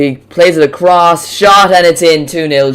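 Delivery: loud, rising goal shout; goal call. Real, not acted. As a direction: call the goal at full volume. Goal call in live commentary.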